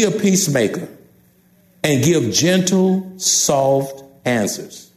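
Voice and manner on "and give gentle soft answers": gentle. Shown as a demonstration. Preacher urging couples to be peacemakers, gentle and slow.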